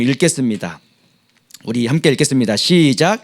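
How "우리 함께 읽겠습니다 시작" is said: ordinary delivery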